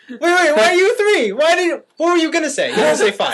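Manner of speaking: loud, high disbelief; incredulous. Real, not acted. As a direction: demand an answer, laughing. Incredulous at the low rating.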